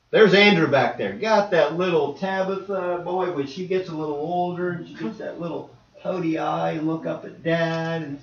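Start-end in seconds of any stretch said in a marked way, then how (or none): none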